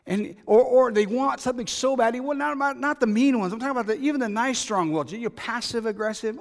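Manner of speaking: loud, high, driving preacher voice; full-force preaching. Acted. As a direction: preach it hard to the congregation.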